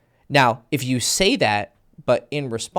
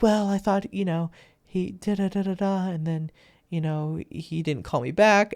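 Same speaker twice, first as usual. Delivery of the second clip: woman's voice; 7 semitones higher than usual. Acted. Voicing the woman's excuse.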